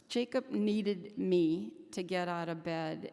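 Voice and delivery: normal talking voice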